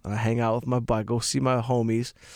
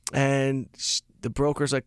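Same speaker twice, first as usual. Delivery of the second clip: ordinary delivery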